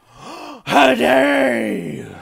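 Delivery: hammed-up, drawn-out high scream; outraged mother shriek. Mother reacts to being kicked by her child, mock outraged shriek. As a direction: mimic an appalled mother screeching, stretching the words.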